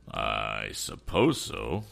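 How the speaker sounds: low, slow, hesitant assent; nervous, dragged-out low reply. A narrator's reading. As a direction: agree reluctantly and nervously, drawing it out. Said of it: "assented Mister Swift nervously".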